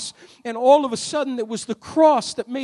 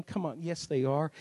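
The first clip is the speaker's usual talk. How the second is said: usual speaking voice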